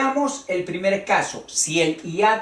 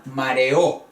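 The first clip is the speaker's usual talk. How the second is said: Mareó with a long, strong final Ó; stress on final O; 4 semitones lower than usual. Shown as a demonstration. Teacher accents a hiatus for students, Mareó stressed on Ó. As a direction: lean hard on the last O of Mareó. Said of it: "estoy acentuando un hiato".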